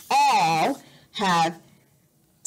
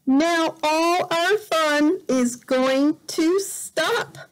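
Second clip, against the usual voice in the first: slow, higher dramatic reading; storybook drama; 6 semitones higher, slower than usual. Acted. Parent reads the sad line to a child, dramatic. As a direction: make it sound like a big worry.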